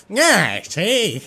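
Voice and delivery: put-on high, loud old-timey gangster drawl; hammed-up vintage villain, pitched high. Player voicing an old timey Joker, old-timey villain. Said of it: "old timey villain".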